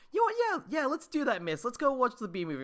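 high, fast eager voice. Retold or imitated. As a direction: gush with excitement. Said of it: "he was like".

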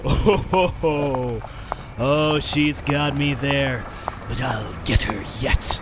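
put-on ho-ho-ho like a creep luring a girl; sleazy stranger chuckle. Stranger sweet-talking the girl, slow and low. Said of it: "so here are the lyrics".

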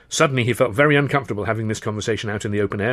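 ordinary delivery

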